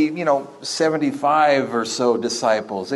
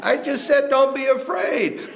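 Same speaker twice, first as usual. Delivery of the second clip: exasperated cartoon Jesus; 12 semitones higher than usual.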